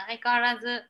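ordinary delivery

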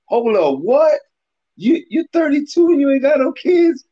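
high, loud mock shock at the age; shocked disbelief. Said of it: "He's like".

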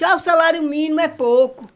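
mock the people's complaint; whiny complaining voice. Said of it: "aí o povo diz".

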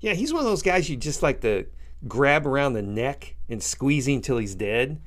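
ordinary talk

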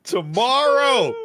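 high, loud and laughing; gleeful and drawn out; laughing excitement. Real, not acted. Laughing excitement over clip.

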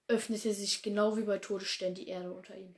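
ordinary delivery, nothing marked

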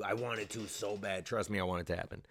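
usual speaking voice

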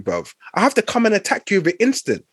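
ordinary talk, nothing marked

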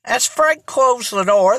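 ordinary delivery

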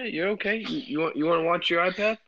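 dad checking on his upset daughter, tender. Real, not acted. Dad comforting scared little daughter.

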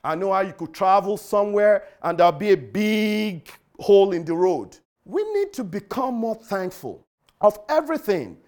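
normal talking voice

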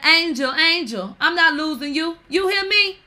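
loud, high, insistent pleading; acted-out plea. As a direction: call out to a lover, hammed-up. Acts out emotional public plea.

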